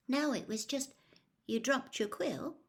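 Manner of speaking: lightly voiced girl, a touch startled; girl's voice. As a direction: speak as a shy girl caught off guard. Cho answering Harry timidly.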